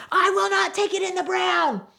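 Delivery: high, loud shrieking yell. Retold or imitated. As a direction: scream it so the whole lot hears. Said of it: "she just yells it so loud".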